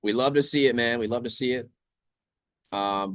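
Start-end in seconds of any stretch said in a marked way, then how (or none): 0.0-1.7 high, loud and thrilled; excited cheer. Real, not acted. Trader cheering gold's drop to viewers, loudly excited. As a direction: cheer it out, pitch raised.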